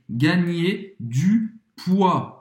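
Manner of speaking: Gagner du poids, slow, poids with no d or s sounded; French poids. Shown as a demonstration. Tutor slowly models poids without d or s. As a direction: say poids as pwa, silent d and s. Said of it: "não vai pronunciar nem o D, nem o S".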